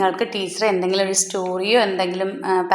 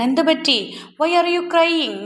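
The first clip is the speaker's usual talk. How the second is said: high-pitched storytime question; lightly acted mother checking on her crying child; concerned mother asking; 7 semitones higher, much slower than usual. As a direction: ask it high, as a mother to her little one. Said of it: "ചിന്നുവിനോട് ചോദിക്കുകയാണ്".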